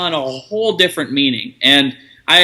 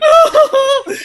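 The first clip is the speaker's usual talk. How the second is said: mock teary oh; 5 dB louder than usual. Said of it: "We're like".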